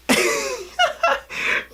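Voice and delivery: very high giddy squeal; excited squealing laugh. Real, not acted. Fan thrilled by the saber catch, excited squealing laugh. Overcome by favourite saber moment.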